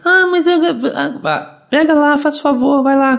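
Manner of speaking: high, put-on whining kid; mock child whine; whiny child. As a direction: grumble like a reluctant child told to tidy up. Parent voices child's complaint.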